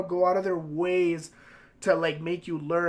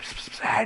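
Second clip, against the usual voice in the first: unvoiced psst to a classmate; whispered psst. Student whispering to buddies during class, hushed.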